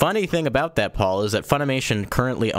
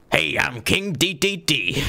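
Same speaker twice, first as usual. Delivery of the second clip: higher, slow put-on mobster growl; growly mob boss King Dedede; 5 semitones higher, slower than usual. Host imitates King Dedede in the anime, as a slow growly mobster. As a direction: swagger through it like a cartoon boss. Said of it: "Like, this growly voice".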